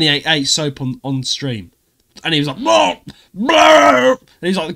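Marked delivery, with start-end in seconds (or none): 2.6-4.1 loud, high retching noise; mock gagging. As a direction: imitate someone gagging, loud and hammed-up. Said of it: "gagging everywhere".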